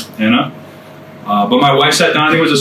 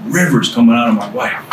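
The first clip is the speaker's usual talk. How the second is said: ordinary talk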